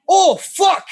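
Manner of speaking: sudden high-pitched yelp of shock; high, loud, strained cry from off to the side; startled yell. Retold or imitated. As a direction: blurt it out like a man who just watched a crash. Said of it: "I'm gonna try to do this with the microphone".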